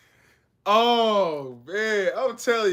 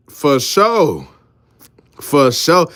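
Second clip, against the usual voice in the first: normal talking voice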